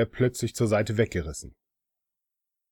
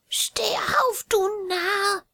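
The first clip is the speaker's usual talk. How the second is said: high, shaky whispered command; a trembling old woman giving orders; much slower than usual. Acted. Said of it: "forderte sie jetzt mit zitternder Stimme".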